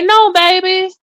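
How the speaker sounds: high, coaxing imitation; high mock plea; mock pleading boyfriend. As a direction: play him begging her back. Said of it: "and say".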